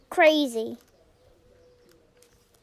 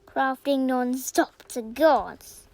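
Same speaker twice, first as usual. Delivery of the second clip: ordinary talk, nothing marked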